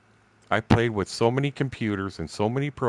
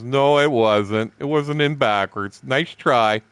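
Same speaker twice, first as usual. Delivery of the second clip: slow, louder, dismissive comeback; defiant retort; 5 semitones higher, 6 dB louder, slower than usual. Retold or imitated. To a lying coworker, a slow scornful denial. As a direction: shut him down, slow and firm. Retold confronting coworker at machine.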